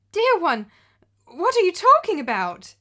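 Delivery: as if the accusation comes from nowhere; shocked exclamation. Acted. Stepmother answering the daughter, shocked and confused. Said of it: "exclaimed the wife".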